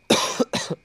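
coughing and choking mid-word; choking, words forced out through a cough. Real, not acted. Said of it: "je m'étouffe".

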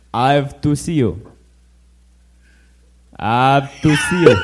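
a light Austrian accent, laughing through it; half-laughing Austrian accent. Shown as a demonstration. Repeating his note for the group, in a light laughing Austrian accent. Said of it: "supposed to be an Austrian".

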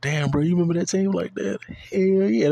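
high, loud hyped exclamation; excited. Acted. Recalling his old 2K squad, a loud excited hell yeah. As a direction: burst out excited, pitch way up. Voicing people, then excited reply.

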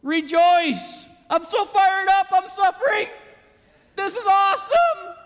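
shouted high, overjoyed rejoicing; fired up. Pretend. Preacher acting a believer rejoicing in suffering, loud and high. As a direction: burst out with exaggerated joy.

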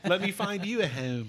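mock Philly accent. Realtor teasing about home, mock Philly accent. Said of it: "We do, like, a Philly accent".

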